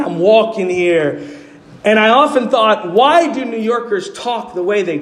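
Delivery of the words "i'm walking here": imitated New York street accent; mock New York accent. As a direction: say it like a New Yorker. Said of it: "known for their accents".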